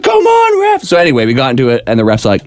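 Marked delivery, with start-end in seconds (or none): 0.0-0.8 complaining to ref. Retold or imitated. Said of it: "He's like".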